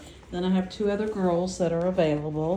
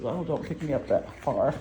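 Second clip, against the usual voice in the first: lightly voiced puppy complaining; a hint of a little puppy protesting; 4 semitones lower than usual. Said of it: "She says".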